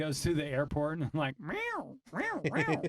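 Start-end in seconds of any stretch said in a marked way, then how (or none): 1.4-2.8 high, repeated meow noise; imitated meowing. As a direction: yowl out quick high meows. Imitating a sound at airport.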